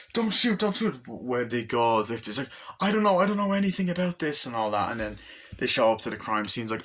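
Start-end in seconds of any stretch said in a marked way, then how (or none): 0.1-1.0 high, imitated panicked plea; mock panic. Acts woman begging not to shoot. 2.8-4.4 high, rapid imitated protest; mock panicked denial. Acts Victor denying everything.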